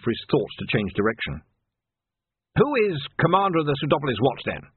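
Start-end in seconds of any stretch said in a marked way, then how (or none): 2.6-4.6 lightly voiced old captain, raised pitch; testing captain. Captain tests Vimes's story, lightly voiced and challenging. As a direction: quiz him in a higher captain's voice.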